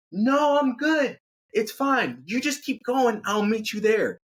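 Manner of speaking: put-on high girlfriend voice calling from afar; calling back up. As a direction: sing out a cheery brush-off. Amy calls back up to Chris.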